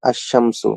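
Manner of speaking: "আশ-শামসু" with a doubled sh and no l; tashdid on sh. Shown as a demonstration. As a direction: hold the sh in "আশ-শামসু". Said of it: "সেখানে তাসদিদ হবে".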